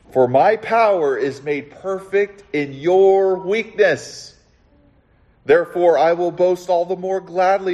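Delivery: Paul's confession read with rising fervor; impassioned scripture reading. Acted. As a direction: build the passage like a sermon climax.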